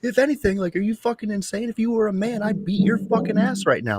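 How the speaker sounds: outrage pitched far up; disbelieving rant at the other parent; incredulous rant. Retold or imitated.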